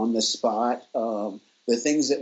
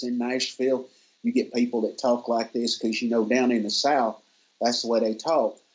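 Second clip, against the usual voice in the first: Southern US accent. Shown as a demonstration. Guest showing host how Nashville people talk, Southern accent. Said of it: "kinda more like this".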